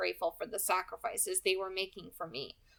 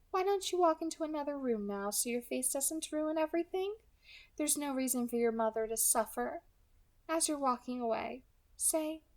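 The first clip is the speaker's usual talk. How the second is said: light, higher, smooth villain voice; 6 semitones higher than usual. Acted. Kidnapper calmly threatening the girl on the phone, pleasant and light. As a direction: menace wrapped in a pleasant voice.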